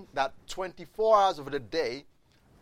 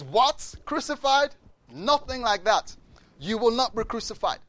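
loud, high disbelief, slowing on the denial; a big man's startled outcry; shocked protest; 8 semitones higher, 6 dB louder than usual. Retold or imitated. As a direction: refuse to accept the news, outraged. Peter rejects Jesus' crucifixion.

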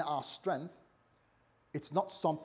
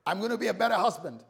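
acted-out eager churchgoer, high and loud; fired-up promises, pitched up and punched out; excited resolve; 7 semitones higher, 6 dB louder, faster than usual. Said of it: "Sometimes we get excited".

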